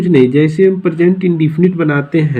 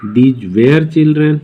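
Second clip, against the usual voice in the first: slow dictation; much slower than usual. Shown as a demonstration. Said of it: "ऐसे ही past में".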